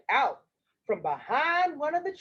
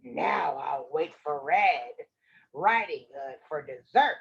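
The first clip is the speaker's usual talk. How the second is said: satisfied wolf; 10 semitones lower than usual. Acted. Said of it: "patted his stomach and said".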